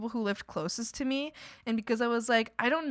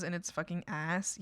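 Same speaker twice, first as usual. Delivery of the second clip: ordinary talk, nothing marked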